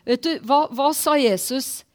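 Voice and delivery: ordinary delivery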